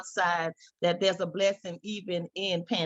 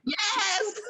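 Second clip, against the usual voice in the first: much higher, laughing "Yes!"; laughing cheer; 14 semitones higher than usual. Real, not acted. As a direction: burst out with joyful laughter.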